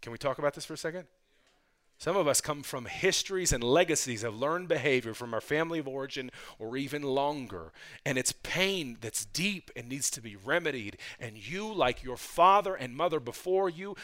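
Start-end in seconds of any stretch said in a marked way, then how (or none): none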